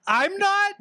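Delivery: high, slow fake sob through the words; mock crying. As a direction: wail it like a hammed-up breakdown. Mock sobbing about not emo enough.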